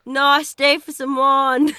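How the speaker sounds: slow, drawn-out Australian-sounding line; too Aussie. Shown as a demonstration. Friend copying the Johannesburg accent but landing on Aussie. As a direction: drawl it slowly like an Aussie. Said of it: "It sounds too Aussies".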